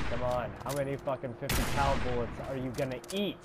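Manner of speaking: ordinary talk, nothing marked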